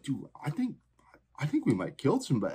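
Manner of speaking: high, hushed worried voice; half-hushed alarmed aside; mock alarmed warning. Speaker retells warning dad.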